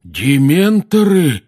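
loud, long drawn-out question; high, slow, aghast exclamation; horrified. A narrator's reading. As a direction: echo it back in horror. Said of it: "в ужасе переспросил наземникус".

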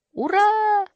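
very high, loud, sustained cheer; high, held hurrah; jubilant shout. A narrator's reading. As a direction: cheer with all your might. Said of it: "продолжал кричать мумитроль".

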